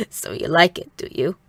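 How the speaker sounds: raspy voice dropping low at the end; raspy, coughing laugh. A narrator's reading. Mother questions the girl about liking the kimono, raspy and amused. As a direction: rasp it through a coughy chuckle. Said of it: "continuing to cough or laugh".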